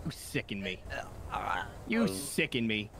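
very low, slow contempt; deep disgusted voice. Acted. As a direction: growl it out with disgust.

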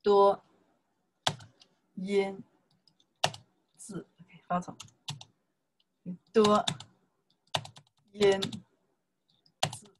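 "多 音 字" one syllable at a time with long gaps; teacher sounding out 多音字 for students. Shown as a demonstration. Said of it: "We learn a lot of 多音字".

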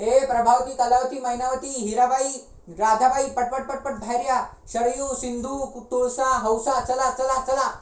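loud roll-call hollered through the house; calling out names. Acted. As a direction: summon everyone urgently. Calls all the women out.

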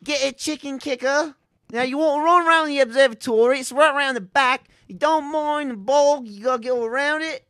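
high, hammed-up English villager accent; Fable English accent. Co-host guiding players round the observatory, Fable English accent. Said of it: "Fable accents".

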